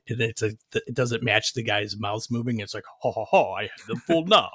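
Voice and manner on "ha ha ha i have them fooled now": bad overdub villain. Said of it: "when they overdub them bad".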